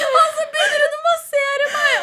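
high, laughing cry; real laugh-cry telling the story; laughing shriek. Real, not acted.

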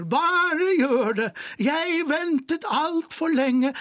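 put-on old actor cheering the lovers on, tearful shout; Per Aabel shouting. A narrator's reading. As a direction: call down from the tower, moved. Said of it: "ropte Per".

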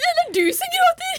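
very high, teary retort; squeaky protest through real tears; tearful. Real, not acted. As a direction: throw the blame back while crying. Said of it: "Jeg gråter fordi jeg".

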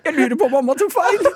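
high voice squeezed by laughter; amused, cracking up confession; laughing while talking. Real, not acted. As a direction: barely hold back the giggles. Laughing at own mother.

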